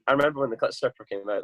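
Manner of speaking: ordinary delivery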